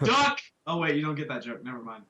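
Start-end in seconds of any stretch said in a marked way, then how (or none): 0.0-0.4 sharp high shout of warning; mock warning shout. Joker shouting at friends as a Cheney gag. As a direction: yell it like a bullet is coming. Dick Cheney shooting joke.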